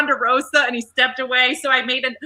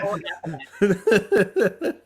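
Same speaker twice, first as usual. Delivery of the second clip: laughing; 5 semitones lower, 4 dB quieter, much slower than usual. Real, not acted. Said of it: "laughing at her own joke".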